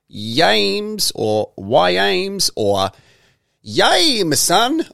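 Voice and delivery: YAMES stretched long, trying readings of a handle; trying out pronunciations. Shown as a demonstration. Host puzzling out a listener's Instagram handle, slow stretched guesses. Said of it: "It's Yames on Instagram".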